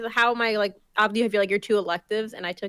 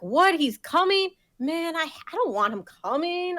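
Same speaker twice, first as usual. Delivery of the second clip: mock dismay; 9 semitones higher than usual. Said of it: "I'm like".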